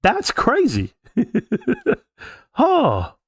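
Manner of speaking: high burst of real laughter; laughing. Real, not acted. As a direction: crack up at how absurd it is. Laughs at the stock tips.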